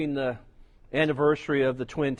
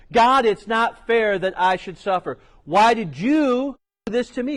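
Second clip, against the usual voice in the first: louder, higher anguished protest; Job's protest; 7 semitones higher, 5 dB louder than usual. Acted. Job protesting his suffering to God, louder and higher. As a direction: cry out at God.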